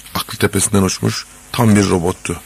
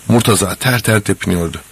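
ordinary delivery

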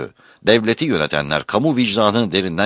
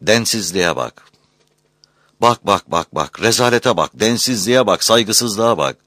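loud indignant bak, bak, bak; outraged; 2 semitones higher, 3 dB louder than usual. Real, not acted. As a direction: hammer each bak with outrage. Outraged rant, repeated bak.

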